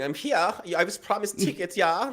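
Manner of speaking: high, entitled put-on voice demanding entry; mock Hitler at heaven's gate. Hitler arrives at the pearly gates, high entitled mock voice. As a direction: play him smugly expecting his tickets. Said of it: "knocking at the pearly gates going".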